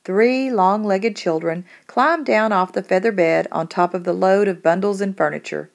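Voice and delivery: ordinary delivery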